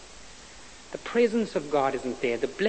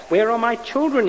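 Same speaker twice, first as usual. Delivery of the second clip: a higher, louder pleading cry; 8 semitones higher, 6 dB louder than usual. A narrator's reading. Man in the dark pleads to find his children. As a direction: beg desperately for the children. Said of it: "he pleaded".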